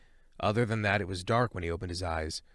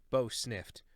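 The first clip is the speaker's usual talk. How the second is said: usual speaking voice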